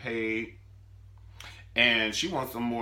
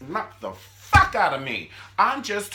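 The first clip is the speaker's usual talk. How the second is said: slow, loud and much higher; loud outburst; 11 semitones higher, 9 dB louder than usual. Retold or imitated. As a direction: yell it out high. Mother's beating, loud outburst.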